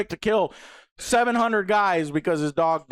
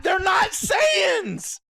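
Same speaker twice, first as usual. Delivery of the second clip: high, outraged shouting; real angry yelling; 11 semitones higher, much slower than usual. Real, not acted. Reviewer ranting about Superman's lore, angry shouting. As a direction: yell it in exasperated disbelief. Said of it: "Why are you fucking yelling".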